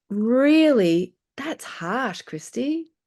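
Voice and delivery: high, pleading disbelief at herself; incredulous self-talk. Retold or imitated. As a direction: speak to yourself like you can't believe it. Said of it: "I was like".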